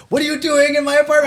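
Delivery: angry boss. Retold or imitated. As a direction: bark at the intruders as the furious boss. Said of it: "It says Indian boss".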